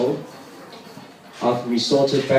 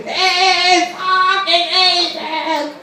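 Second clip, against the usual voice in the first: broad Aussie yell, much higher, drawn out; Aussie accent; 3 dB louder, much slower than usual. Retold or imitated. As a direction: belt it like a passing Aussie bogan, high and stretched. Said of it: "That's the best Aussie accent I can do".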